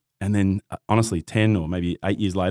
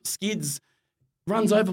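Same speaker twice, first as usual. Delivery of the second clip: usual speaking voice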